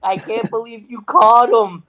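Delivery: high, stretched-out disbelief; mock astonishment. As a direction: imitate a friend's stunned cry. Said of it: "hands on his head".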